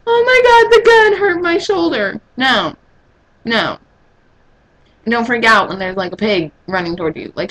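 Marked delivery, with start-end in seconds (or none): 0.1-2.2 high, wailing mock panic; freaking out. Mocking a man upset after shooting a deer, freaking out. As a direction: wail it like a hammed-up wimp. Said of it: "and not freak out because".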